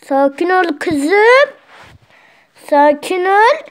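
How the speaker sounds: calm the bird down, slow and drawn out; soothing. Real, not acted. Calming the restless parrot. (papağanı yatıştıran yavaş, yüksek ses)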